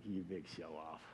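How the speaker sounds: very low, hushed grumble; grudging aside. Retold or imitated. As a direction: grumble it low under your breath. Retold grudging aside to his son.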